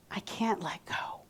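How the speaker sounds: hushed whisper of a client who can't let go. Retold or imitated. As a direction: breathe it out, barely there. Client voicing her clutter excuse.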